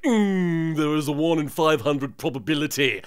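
nasal-free mock know-it-all drone; lightly mocked Bastion. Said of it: "Bastion's like".

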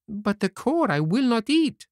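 high-pitched indignant refusal; angry puppet. Acted. Puppet refuses to eat the core, high angry tone. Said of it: "in an angry tone".

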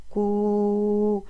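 كو held long, with a stretched oo. Shown as a demonstration. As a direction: draw out كو so the long u rings. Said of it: "ومع مد الواو".

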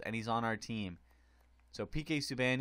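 ordinary talk

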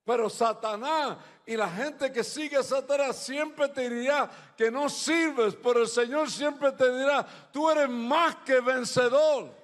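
high, loud, emphatic preacher; preaching at full force. Real, not acted. Preacher assuring listeners God says they serve, full-force preaching. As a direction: drive each phrase home loudly, high-pitched.

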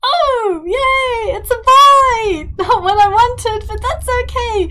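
high, loud squealing celebration; excited cheer. Real, not acted. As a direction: shout it joyfully at high pitch. Celebrating the baby's birth.

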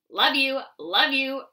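loud mock croak; croaking brother. A narrator's reading. Toad brother croaking to little toads, loud croak. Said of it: "Brother croaked".

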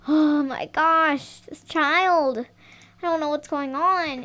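usual speaking voice

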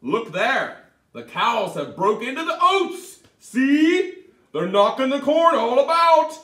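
high, loud scolding; sharp leprechaun. Acted. The leprechaun scolding Tom to get rid of him, loud and high. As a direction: snap at him to go away. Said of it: "answered Tom sharply".

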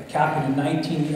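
normal talking voice

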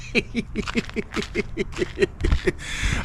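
long, breathless tittering at himself; giggling. Real, not acted. Vlogger finding his own intro corny, wheezy giggling.

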